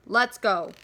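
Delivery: loud, quick command; rally the gang; big boy rallying the others; urging shout. Acted.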